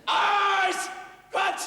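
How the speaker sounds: high, drawn-out parade-ground command; drill shout. Acted. Sergeant calls the squad to eyes front, shouting. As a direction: bellow it across the parade ground. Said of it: "all this shouting all the time".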